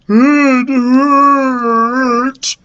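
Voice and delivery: loud, high, drawn-out moan; tearful groaning voice; pained wail. A narrator's reading. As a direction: howl it like a hurt ogre. Ogre moans in pain.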